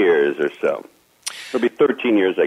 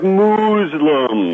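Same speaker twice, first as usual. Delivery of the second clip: very slow, stretched-out delivery; dragged-out words; 7 semitones higher than usual. Retold or imitated. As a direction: drag the word out long. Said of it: "he drags words out".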